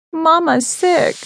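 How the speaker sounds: put-on high, slow little boy voice; a small child worried for his mama; young child. Small son telling his sick mother, high and slow.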